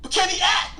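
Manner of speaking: loud, high mock outrage; indignant shout. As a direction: demand it loudly, half joking. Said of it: "I'd be like".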